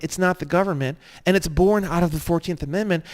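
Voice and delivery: usual speaking voice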